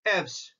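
Aves with a short E, squeezed to "EVS"; American English pronunciation. Shown as a demonstration. Lecturer showing how American English says Aves, short-vowel American pronunciation. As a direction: say Aves as one syllable, like "evs". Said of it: "in American English".

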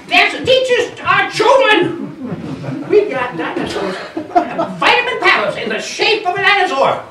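high, loud, cackling crank convinced he's right; a wild-eyed backwoods know-it-all, laughing at his own cleverness; eccentric dinosaur believer. Acted. The crank convincing Merle dinosaurs are everywhere, high and loud with laughter.

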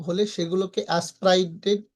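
ordinary talk

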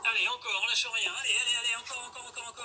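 loud, high hype shouting; gym bro. Acted. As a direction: push them hard through the final reps. Coach cheering on the last reps.

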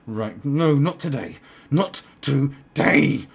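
hammed-up angry shout, slowing at the end; mock outburst. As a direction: act someone snapping, building to drawn-out syllables. Acts out a passer-by snapping.

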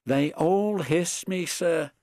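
a touch of high, plaintive sadness; sad. A narrator's reading. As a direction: complain sadly to the boss. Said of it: "answered Edward sadly".

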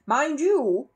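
a bossy neighbour explaining; neighbour's voice. A narrator's reading. Neighbour explains the stakes to the bear, gruff.

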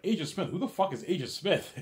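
high, loud disbelief; who on earth is that; incredulous. Retold or imitated. Incredulous about Agent Smith.

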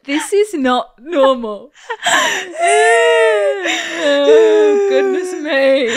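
slow words dissolving into high crying laughter; real helpless, tearful laughing; overcome with laughter. Real, not acted. Host reacting to the panting boyfriend, overcome with laughter.

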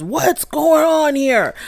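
slow, high mock thunder; slow hammed-up authoritative question. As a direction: boom it out like a stern teacher. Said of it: "he had all that thunder in his voice".

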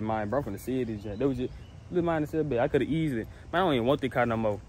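normal talking voice

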